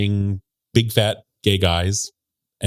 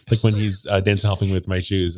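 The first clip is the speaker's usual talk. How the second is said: usual speaking voice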